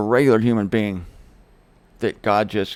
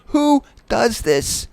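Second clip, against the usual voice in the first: "Who does this?" stretched very slowly and high; slow incredulous; 11 semitones higher, 3 dB louder, much slower than usual. Shown as a demonstration. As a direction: drag every word out in disbelief.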